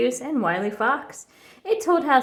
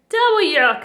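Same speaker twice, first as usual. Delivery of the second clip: high, louder disgusted "Double yuck"; cub's double yuck; 8 semitones higher, 6 dB louder than usual. A narrator's reading. Brother outdoing Sister's disgust, louder yuck. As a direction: top your sister's disgust.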